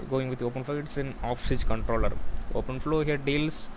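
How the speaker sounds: usual speaking voice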